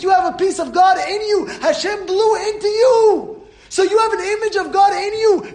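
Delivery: high, impassioned preacher's shout; full-force preaching. Real, not acted. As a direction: drive it home like a fired-up preacher.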